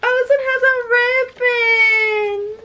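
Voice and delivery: high, wailing squeal of joy; tearful delight. Real, not acted. Finding a ribbon in the gifted book, tearful delight. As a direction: wail it happily, close to tears. Overjoyed at gift's detail.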